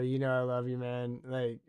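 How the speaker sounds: ordinary delivery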